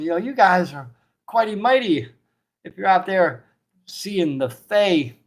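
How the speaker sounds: high, loud, booming innkeeper; hearty barkeep. Acted. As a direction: praise the heroes like a jolly host. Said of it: "The barkeep goes".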